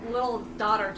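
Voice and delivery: ordinary talk, nothing marked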